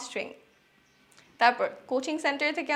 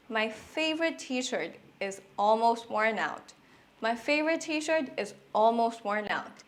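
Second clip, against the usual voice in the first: slow model sentence; much slower than usual. Shown as a demonstration. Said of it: "ইংরেজিতে বলতে পারি".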